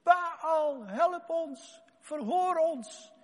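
high pleading cry; high drawn-out plea to Baal; priests crying out. Acted. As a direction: beg the idol loudly. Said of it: "ze begonnen te roepen".

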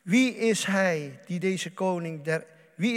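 psalm recitation. Acted. Preacher reads the psalm to the congregation, proclaiming loudly. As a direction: call it out like a herald at the gates. Reading Psalm aloud, raised voice.

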